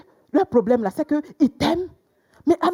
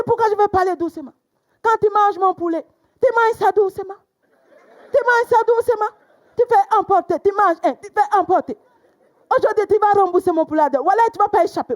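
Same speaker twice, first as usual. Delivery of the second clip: high, loud mock scolding; hammed-up furious tirade; loud angry boyfriend; 9 semitones higher, 3 dB louder than usual. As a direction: play the angry boyfriend shouting. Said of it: "il parlait fort".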